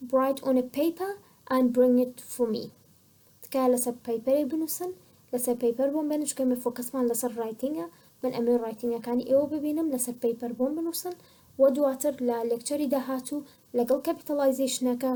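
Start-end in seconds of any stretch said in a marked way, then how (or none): none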